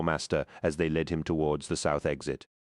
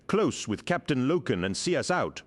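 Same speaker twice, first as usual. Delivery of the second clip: raised, urgent order; yelled order; 8 semitones higher than usual. A narrator's reading. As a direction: bark the order over the fight. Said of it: "Torgadon yelled".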